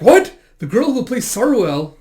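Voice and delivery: high, loud shocked outburst; startled disbelief. Acted. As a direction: blurt it out as a stunned fan. Acted character's startled reaction.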